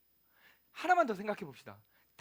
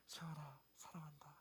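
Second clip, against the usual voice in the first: soft unvoiced whisper of love; tender whispered name and love; whisper in the ear; 5 semitones lower, 20 dB quieter than usual. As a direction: breathe it right into her ear. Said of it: "귀에다가 딱 대고".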